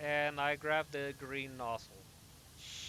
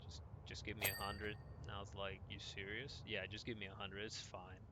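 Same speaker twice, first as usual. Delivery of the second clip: low-key owner; 4 semitones lower, 10 dB quieter than usual. Retold or imitated. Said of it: "This guy's like".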